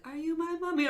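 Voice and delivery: put-on high-pitched eerie child voice; creepy child. Gas-mask kid on Doctor Who asking for mommy, creepy child voice. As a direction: pipe it up like a little child. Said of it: "they only say one thing".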